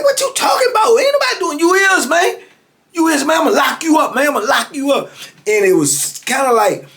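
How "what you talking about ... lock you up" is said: retold angry shout. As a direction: bark it as a furious guard threatening lockup. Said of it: "And dude's like".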